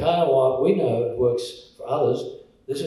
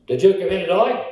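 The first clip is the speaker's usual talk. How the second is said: put-on broad Aussie accent; 5 semitones higher, 7 dB louder than usual. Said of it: "save them from my accent".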